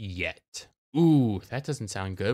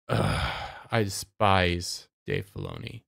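disgusted; 9 semitones lower, 5 dB quieter than usual. Real, not acted. Disgusted rant.

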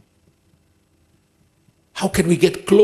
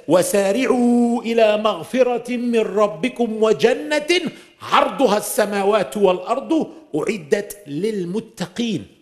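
fast, higher chanted Arabic recitation; Quran recitation; 6 semitones higher, much faster than usual. Acted. Preacher recites a verse to the congregation in quick melodic chant.

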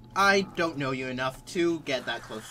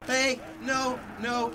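scared yelp; 8 semitones higher, slower than usual. Real, not acted. Zombie attack scare.

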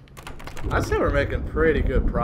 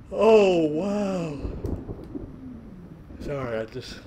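yawning; 5 semitones higher, slower than usual. Real, not acted. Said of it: "I'm just, just yawning".

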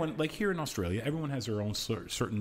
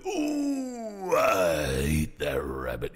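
Sam cartoon voice; 6 semitones lower, 4 dB louder, much slower than usual. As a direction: bellow it like a hammy cartoon cowboy. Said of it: "you hear all these different voices".

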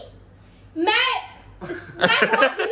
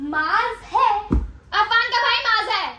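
normal talking voice

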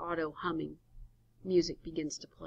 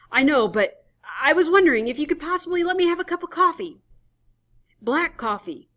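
desperate plea; 10 semitones higher, 12 dB louder, faster than usual. Acted. Adams pleading at closed stand.

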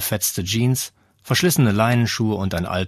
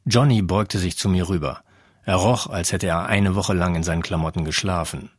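ordinary delivery, nothing marked

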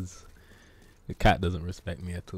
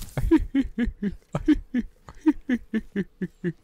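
high chuckle like the Simpsons doctor; Doctor Hibbert laugh; 12 semitones higher than usual. Pretend. Streamer doing a Doctor Hibbert impression, high chuckle. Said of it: "my Doctor Hibbert impression".

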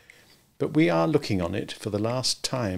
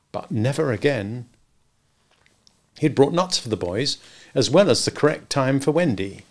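usual speaking voice

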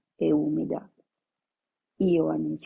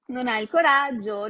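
louder, higher playground taunt on "coraggio"; 5 semitones higher, 5 dB louder than usual. Acted. Leo mocking Ioan's threat to tell, loud taunt. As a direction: tease him that he'd never dare. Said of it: "Canzonò Leo".

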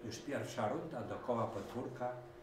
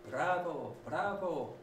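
higher, louder bravo cheer; 8 semitones higher, 4 dB louder, slower than usual. A narrator's reading. Horse and cow cheering the ride, calling. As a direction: cheer them on. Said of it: "wołali koń Maurycy i krowa Krasula".

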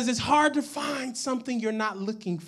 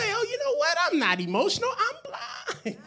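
high-pitched, comic dawning discovery; mock realisation; 10 semitones higher, 3 dB quieter than usual. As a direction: act out the penny dropping, leaping up high on the punchline.

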